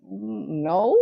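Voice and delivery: a small, drawn-out admission; Opie. Retold or imitated. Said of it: "And he goes".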